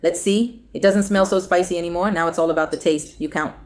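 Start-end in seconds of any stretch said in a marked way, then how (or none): none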